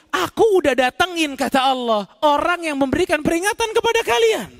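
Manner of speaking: rising, forceful reproach from the pulpit; preaching rebuke. Acted. Preacher voicing God's answer to the damned, stern reproach. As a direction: scold the audience as God answering.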